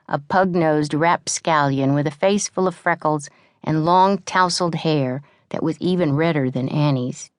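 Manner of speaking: ordinary delivery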